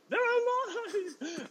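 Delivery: drawn-out, very high mock cry; jubilant child. Boy holding up all three chicks, jubilant mimicry. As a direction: stretch it out slowly in imitated triumph.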